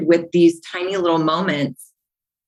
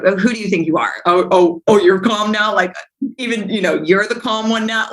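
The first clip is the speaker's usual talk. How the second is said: lightly voiced husband scoffing at her calm; mocking sarcastic husband. Retelling her husband's sarcastic jab in a fight, mock sneer.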